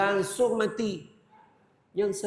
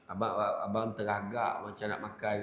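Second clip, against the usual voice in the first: timid stammering husband; 7 semitones lower than usual. Retold or imitated. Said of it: "dia marah dia".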